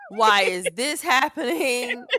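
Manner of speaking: laughing, half-sobbed plea; mock crying lament. Mother retelling her breakdown on the floor, laughing wail. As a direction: whine to the heavens, half laughing. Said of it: "I just started crying".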